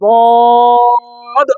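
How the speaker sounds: বদ held long; teacher's model letter; Arabic dad sound. Shown as a demonstration. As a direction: watch and hear বদ pulled back. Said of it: "আমি বলি".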